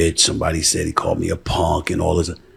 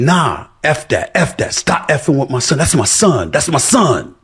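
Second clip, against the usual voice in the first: furious father; 7 semitones higher, 4 dB louder than usual. Retold or imitated. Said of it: "how furious he was".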